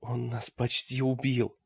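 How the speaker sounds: soft, hushed self-defence; defensive whisper. A narrator's reading. Олег excuses himself to Мрак, hushed. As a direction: murmur it softly in your defence. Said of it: "прошептал Олег, защищаясь".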